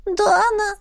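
high, crying call; begging little animal call; pleading otter cry. A narrator's reading.

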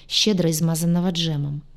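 usual speaking voice

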